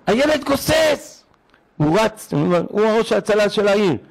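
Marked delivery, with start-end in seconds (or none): 0.1-1.2 panicked cry. Retold or imitated. Daughter reports the dying child.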